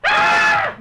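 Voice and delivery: very high, loud shriek of fright; frightened scream. Acted. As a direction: shriek as something moves in the dark. Startled again by shadow. (cri aigu de frayeur)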